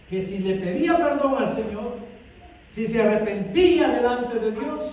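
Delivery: ordinary talk, nothing marked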